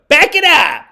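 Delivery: sudden loud yell; audio-peaking shout. Pretend. Telling the government to retreat, mock warning shout. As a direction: bellow it as a warning to back off. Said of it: "is that peaking the audio".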